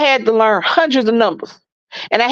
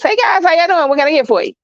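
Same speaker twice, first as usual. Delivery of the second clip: higher, bright-eyed server greeting, extra friendly; fluffy voice; 5 semitones higher than usual. Shown as a demonstration. Server greeting customers in her fluffy upbeat voice. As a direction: sweeten it up like a cheerful waitress. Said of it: "This is my fluffy voice".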